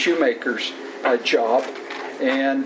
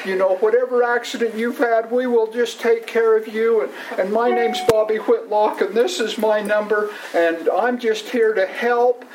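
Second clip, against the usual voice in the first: reassuring clerk; 6 semitones higher than usual. Retold or imitated. Bobby voiced reassuring injured caller.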